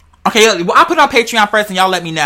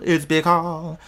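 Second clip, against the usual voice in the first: lower, softer drawn-out phrase; imagined saying it; 4 semitones lower, 9 dB quieter, much slower than usual. Acted. Imagining somebody saying that, quiet and low. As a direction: say it low and soft. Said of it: "I can see somebody saying that".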